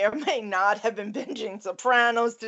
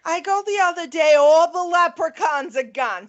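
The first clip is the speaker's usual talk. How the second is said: higher, louder exasperated complaint; indignant rant; 7 semitones higher, 5 dB louder than usual. Real, not acted. Host complains to co-host about vanished films, indignant. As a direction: rush in with loud, high indignation.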